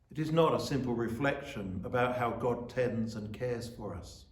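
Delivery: ordinary talk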